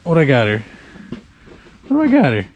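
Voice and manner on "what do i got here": higher, curious lift on got; surprised. Real, not acted. Angler wondering what took his bait, surprised. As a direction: pitch it up in surprise.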